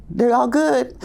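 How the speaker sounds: put-on a child piping up happily; cheerful high kid voice. Teacher voicing a toddler, high and cheerful. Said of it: "And it's like".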